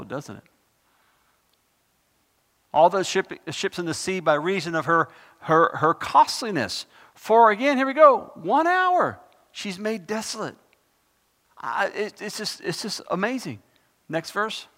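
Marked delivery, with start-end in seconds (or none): 2.7-7.5 scripture reading. Acted. Reads scripture aloud. 8.3-10.6 loud, slow, solemn scripture reading. Acted. Preacher reads Revelation to the congregation, loud and slow.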